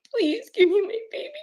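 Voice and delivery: high, tearful pleading; real crying. Real, not acted. Mother recalls begging for her baby back, crying. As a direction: beg through the tears.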